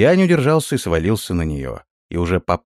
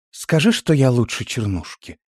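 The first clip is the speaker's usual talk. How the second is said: pouting, wheedling demand; a spoiled sulky plea; capricious; 6 semitones higher than usual. A narrator's reading. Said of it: "капризно произнесла Ганга". (капризно)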